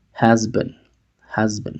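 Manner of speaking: "has been" with the vowel reduced to schwa, hez-ben. Shown as a demonstration. Teacher modelling for students, reduced schwa. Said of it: "Se reduce esa A a un sonido schwa".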